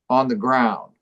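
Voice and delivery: ordinary delivery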